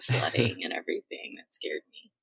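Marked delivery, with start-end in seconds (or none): 1.4-2.1 hushed whispered confession; whispering. Real, not acted. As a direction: drop to a whisper. Admitting fear of floods.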